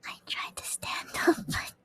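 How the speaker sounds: soft whispered aside; whispering. Real, not acted.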